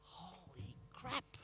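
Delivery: a slow, dismayed murmur to himself; inner thought. Retold or imitated. Speaker dreading talking to health experts, slow muttered. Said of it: "I thought".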